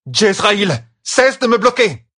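loud, high-pitched angry yell; furious shouted demand; roared. A narrator's reading. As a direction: roar it at a colleague in your way. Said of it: "rugit Catherine".

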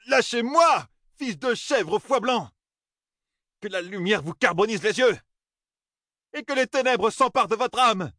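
very high, frantic outcry; furious cursing. A narrator's reading. Young man carried off by captors, furious cursing. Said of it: "se débattre et maudire ne servit à rien".

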